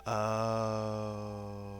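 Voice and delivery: ordinary talk, nothing marked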